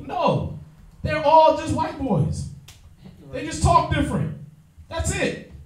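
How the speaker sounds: preacher-style high emphasis; emphatic high. Real, not acted. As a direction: hammer the point home, high and insistent. Emphatic point to class.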